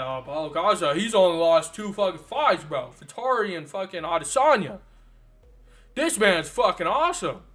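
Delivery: loud imitation of a casual fan; mock fan hype. Host imitating casual fans praising a fighter, loud mock hype. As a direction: talk him up loudly like a hyped fan.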